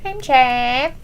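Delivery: lightly imitated Spanish accent; awkward fake Spanish accent; mock Spanish accent. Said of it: "trying to speak with his Spanish accent".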